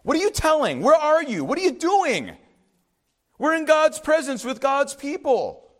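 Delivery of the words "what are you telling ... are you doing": raised, higher voice scolding; mock yell. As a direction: play the one barking questions. Acting out shouting at someone.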